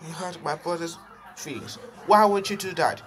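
high-voiced indignant scolding; high sister voice. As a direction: scold him as an outraged little sister. Angry sister voice scolds Bowser.

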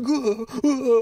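frantic grunts. Retold or imitated. Mocking Sarah all over the place, frantic grunts. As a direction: imitate someone wildly off their head, "uh, uh". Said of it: "she's so like".

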